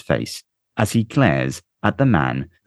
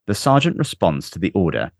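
ordinary delivery

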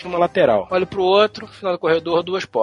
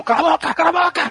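neighbour yelling, imitated a bit higher; mock angry shout; 10 semitones higher than usual. Imitating the neighbour man shouting through the wall, mock angry. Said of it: "um homem que ... falava alguma coisa como".

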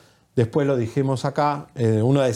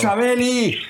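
calling out; 7 semitones higher, slower than usual. Real, not acted. Calling caller on dead line.

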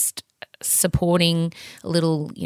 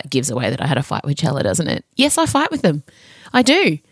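normal talking voice

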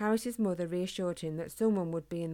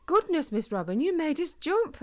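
louder, much higher startled cry; startled exclamation; 9 semitones higher, 5 dB louder than usual. A narrator's reading. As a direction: jump at the sudden voice. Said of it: "exclaimed Harris".

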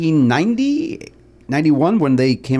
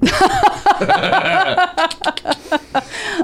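long high buzzing insect drone; cicada buzz. Shown as a demonstration. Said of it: "He's been sitting on that cicada impression".

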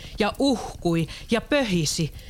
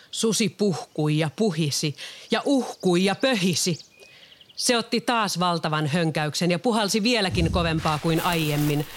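ordinary delivery

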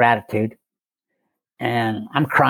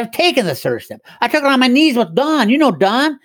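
insistent protest; 11 semitones higher than usual. Retold or imitated. Retold insistence to Conklin.

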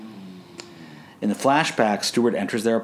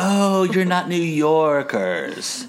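high, slow, snooty drawl; Janice impression; 10 semitones higher, 4 dB louder, much slower than usual. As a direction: sneer like a snobby agent. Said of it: "a lot like Janice".

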